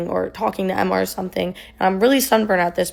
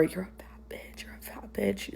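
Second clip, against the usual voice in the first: whispered insult; 2 semitones lower, 15 dB quieter than usual. Retold or imitated. Quoting boyfriend's repeated insults to viewers, very hushed whisper. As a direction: whisper the repeated name-calling.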